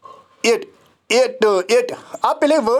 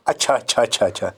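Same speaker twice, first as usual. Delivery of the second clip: put-on soothing a child; 10 semitones lower, faster than usual. Teacher imitating a parent soothing a child. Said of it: "जैसे हम बच्चे को कहते हैं ना".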